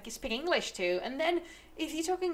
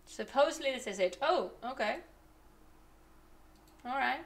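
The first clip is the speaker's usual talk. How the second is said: ordinary delivery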